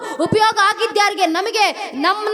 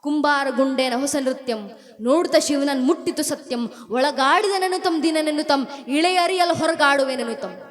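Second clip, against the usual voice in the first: put-on lower, soft recital of old verse; poem recital; 7 semitones lower, 3 dB quieter than usual. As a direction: intone the verse gently. (ಮೃದುವಾದ ಪದ್ಯ ಪಠಣ)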